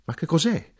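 very high, alarmed cry; shout. Acted. Narrator shouting to his mother about the gunfire.

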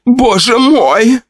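very high, slow, drawn-out wail; wife crying out over the murdered maid; horrified cry. Acted. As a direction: cry out in horror. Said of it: "кричала Павлова".

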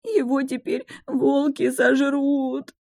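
slow, high tearful wail; sobbing wail. A narrator's reading. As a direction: whimper it through tears. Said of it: "а я, рыдая, заскулила".

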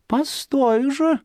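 high, slow, scheming fox voice; a sly fox plotting, drawn out high. A narrator's reading. Fox to herself, plotting to find Zhikharka, sly high voice. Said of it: "думает лиса".

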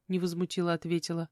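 usual speaking voice

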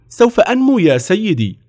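put-on high-pitched boyish insistence; eager pleading boy. A narrator's reading. Napoleon answering the officer's objection, insistent.

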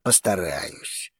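slow, quiet, sour answer; sour reply. Acted. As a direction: say it sourly, reluctantly. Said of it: "кисло ответил Вулкан".

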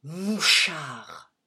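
a loud strangled cry of rage; loud rage-choked accusation. A narrator's reading. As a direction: force it out through choking fury. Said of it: "d'une voix étouffée par la rage".

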